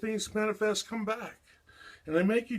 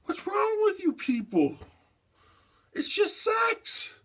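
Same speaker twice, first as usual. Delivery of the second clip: raised voice, fed up and pitched up; exasperated outburst; 9 semitones higher than usual. Real, not acted. Ranting at people about sex, fed up outburst. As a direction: tell them off sharply.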